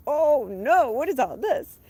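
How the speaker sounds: a hammed-up startled gasp of thoughts; mock alarm. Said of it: "I'm like".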